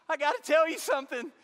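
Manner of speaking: wife breaking bad news; worried wife. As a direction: blurt it out, anxious. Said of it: "she was like".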